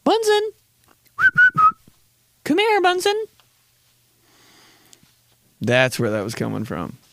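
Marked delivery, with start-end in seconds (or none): 0.1-3.2 high-pitched playful beckoning; mock pet call, very high. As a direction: coo for a pet in falsetto. Pretends calling Bunsen like a pet.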